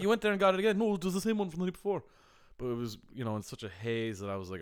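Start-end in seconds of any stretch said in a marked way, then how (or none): 0.0-2.0 high, louder mock exchange; retold back-and-forth. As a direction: act out both sides in a raised voice. Retold exchange about the cheese.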